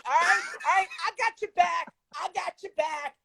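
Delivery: high-pitched, gloating caricature, loudest on the opening taunt; triumphant comic impression in a high put-on voice; Amy Coney Barrett. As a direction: cackle a drawn-out gotcha, then keep the voice pitched high. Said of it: "Amy Coney Barrett impression".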